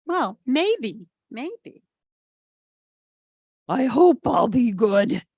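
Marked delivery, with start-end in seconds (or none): 3.7-5.3 louder, hopeful puppy voice; a dog hoping to behave; hopeful George. A narrator's reading. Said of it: "says George, or he thinks it".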